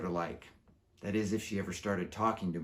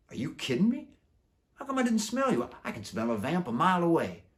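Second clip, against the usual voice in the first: high, louder, can't believe it; stunned disbelief; 9 semitones higher, 5 dB louder than usual. Acted. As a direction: gasp it out in disbelief. Darkheart in disbelief.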